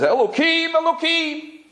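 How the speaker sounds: high, pleading cry to the heavens; a high wailing plea upward; crying out to God. Retold or imitated. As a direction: call out to God in desperation.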